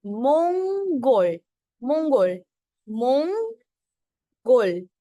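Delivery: teacher drilling मंगोल, loud and slow; slow pronunciation drill. Shown as a demonstration. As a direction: stretch मंगोल so the ng sound is clear. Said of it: "नीचे वाला अंग तो pronounce होगा".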